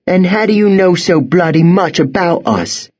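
high, suspicious and sharp; suspicious angry girl. Acted. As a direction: challenge him.

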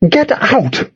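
high, sharp teacher's order; demanding. A narrator's reading. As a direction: order them out firmly. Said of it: "Missus Levine demanded".

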